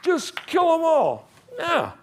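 hammed-up call for slaughter; mocking bloodthirsty soldiers.

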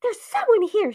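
high, urgent stage whisper; breathy, high-pitched panicked whispering; frightened whisper. A narrator's reading. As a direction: whisper it forcefully in alarm. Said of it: "she whispered".

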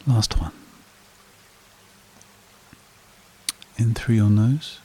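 slow, calm meditation voice with long pauses; guided meditation. Acted. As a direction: lead a breathing exercise, unhurried, leaving long silences.

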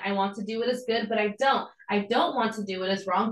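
normal talking voice

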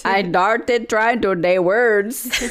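laboured fake Indian accent with Ds for Ts; mock Indian accent. Shown as a demonstration. Trying the trick on new words, muddled Indian accent.

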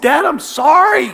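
high, wailing mock apology; acted tearful whine, pitched well up; imitated bawling son. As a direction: plead like a sobbing kid. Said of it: "He's just bawling".

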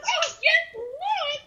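reenacting the fleeing movie girl, hammed-up wail; dramatic mock cry. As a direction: cry out to the Lord in hammed-up panic. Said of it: "And she goes".

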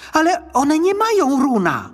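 put-on high-pitched boyish protest; boy's voice. A narrator's reading. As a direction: object urgently as a young boy. Boy protests to goblin.

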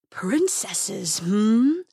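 drawn-out dragon musing, lightly voiced. A narrator's reading. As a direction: toy with the word as a dragon. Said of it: "said the dragon, smiling".

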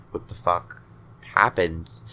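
ordinary talk, nothing marked

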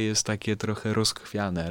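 ordinary delivery

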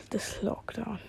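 whisper. Real, not acted. Whispered aside.

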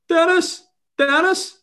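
high, loud call from across a crowd; shouting. Retold or imitated. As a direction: imitate a dad yelling out for attention. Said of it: "a Northern Irish accent shouting".

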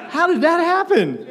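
high, incredulous question; laughingly baffled by the switch; amazed disbelief. Retold or imitated. Kid reacting to sudden sweet phone voice, amazed.

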